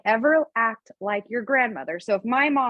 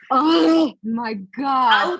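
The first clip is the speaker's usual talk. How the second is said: loud, drawn-out, higher-pitched astonishment; real shocked reaction; 4 semitones higher, 6 dB louder, much slower than usual. Real, not acted. Reacting to a six-year-old's "Your mom, huh?", loud stunned exclamation. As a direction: cry out slowly, stung and amazed.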